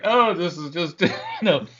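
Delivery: laughing gush. Retold or imitated. Surgeon's wife laughing at the ritual.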